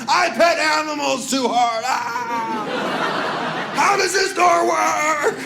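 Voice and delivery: high, loud, slow yelling; mock frantic wail. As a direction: panic like a fleeing beast. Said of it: "I'll tell you how it felt".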